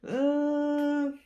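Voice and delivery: high, long-held doubtful "uhhh"; mock stalling noise on one high note; hesitant "uh". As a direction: imitate a reluctant, drawn-out high hum. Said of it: "the professor was like".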